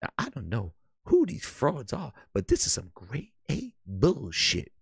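sulky console architect muttering low; mock Mark Cerny. Streamer voices a cussing console architect, low and soft. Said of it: "Cerny, thank you very much".

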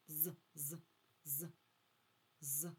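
"з" as a short lone buzz, repeated; isolated sound з. Shown as a demonstration. Teacher isolating the first sound for children, short separate "з". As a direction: sound out "з" by itself, pausing between repeats. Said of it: "перший звук, який ми чуємо".

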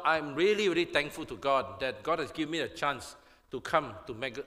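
ordinary delivery

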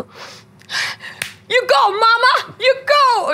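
high, loud cheer for mom; root for her like a fan. Retold or imitated. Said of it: "Und ich war so". (die Tochter feuert ihre Mutter begeistert und hoch an)

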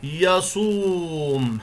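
يصوم with the و stretched out slowly over two beats; long vowel demo. Shown as a demonstration. Teacher to pupils, showing how يصوم is lengthened: long و slowly. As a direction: draw out the و in يصوم, syllable by syllable. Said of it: "وهي كلمة يصوم".

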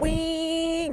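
long, very high "wing" boat noise; a high, drawn-out motor whine; imitated motor whine. Telling friends about gunning the jet boat, high imitated engine whine.

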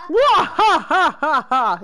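loud, high mock villain cackle; maniacal laugh. Gamer copying the villain's laugh, maniacal cackling. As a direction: belt out a fake evil laugh, falling away. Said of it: "Maniacal laugh".

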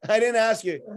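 irritated customer retort; mock snap. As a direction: cut the nosy shopkeeper off. Snapping at nosy shopkeeper.

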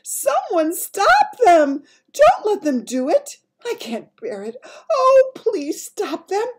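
high, loud terrified sleep-talk cries; crying out in a nightmare. A narrator's reading. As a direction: scream out of a bad dream. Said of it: "it cried out".

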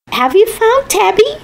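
put-on a girl hoping Dad found her cat; hopeful little girl. A narrator's reading. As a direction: ask like a child desperate for good news. Girl asks Dad eagerly.

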